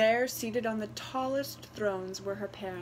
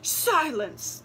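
much louder, higher thundering command; thundered; 7 semitones higher, 7 dB louder than usual. A narrator's reading. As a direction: blast it to silence her. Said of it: "they thundered".

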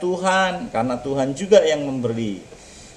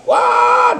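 frightened yelp; 6 dB louder than usual. Acted. Boy sees fanged devil.

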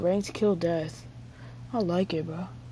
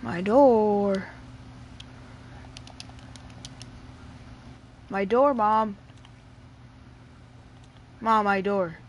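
calling out; 3 dB louder, slower than usual. Real, not acted. Calling Mom to shut door.

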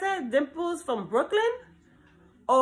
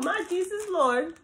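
ordinary talk, nothing marked

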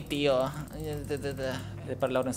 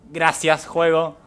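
loud, higher mock gratitude; sarcastic loud thanks; 6 semitones higher, 11 dB louder, much slower than usual. Real, not acted. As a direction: yell thanks at the game, annoyed.

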